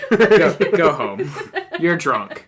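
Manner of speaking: mock scold between laughs; laughing tease. Real, not acted. Friend teasing about the pun, laughing.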